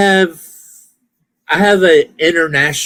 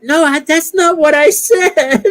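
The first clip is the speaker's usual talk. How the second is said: laughing protest; 14 semitones higher than usual. Retold or imitated. Mocking voice-to-text getting it wrong.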